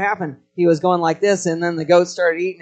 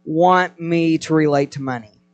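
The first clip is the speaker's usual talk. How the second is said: very slow and louder; slow, weighty question; 2 semitones higher, 5 dB louder than usual. Real, not acted. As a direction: stretch out "How does God" for weight. Preacher slows for key question.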